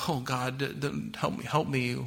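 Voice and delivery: soft, faltering cry for help; desperate plea. Retold or imitated. Preacher imagining himself begging God, softly stammering. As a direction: plead quietly, stumbling on the words. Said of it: "I'd be like".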